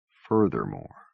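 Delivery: Furthermore said low and flat in scorn; mocking. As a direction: echo Furthermore with a dry sneer. Norton repeats it scornfully.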